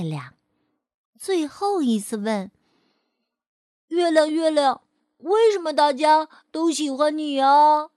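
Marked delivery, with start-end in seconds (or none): 3.9-7.9 put-on louder, higher child call upward; calling to the moon. Fei'er calling up to the moon, loud and hopeful. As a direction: call out to the sky like a curious little boy.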